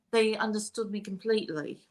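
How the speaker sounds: playful English accent impression; British accent. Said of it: "British accents".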